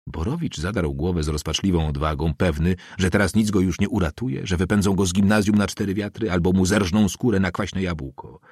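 plain reading voice

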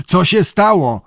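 captain's mildly shouted question; shouting. A narrator's reading. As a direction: call out in alarm, short of a full shout. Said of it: "krzyknął kapitan".

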